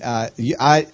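ordinary talk, nothing marked

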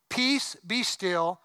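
slow, drawn-out command to the sea. Acted. Pastor reading Jesus to the storm, slowly. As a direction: stretch each word of the command.

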